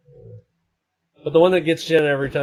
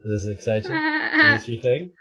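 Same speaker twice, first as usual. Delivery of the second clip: silly voice. Pretend. Streamer teasing chat with a pickle filter, squeaky silly voice. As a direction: tease the viewer in a squeaky character voice. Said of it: "my silly voices".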